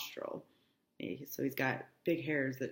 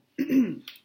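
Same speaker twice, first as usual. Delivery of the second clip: throat clearing; 7 semitones higher, 8 dB louder than usual. Real, not acted.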